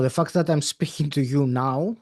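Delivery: normal talking voice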